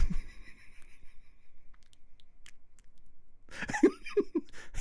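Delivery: long, wheezy breath of laughter; real laughter. Real, not acted. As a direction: crack up at your own story. Laughs at his own story.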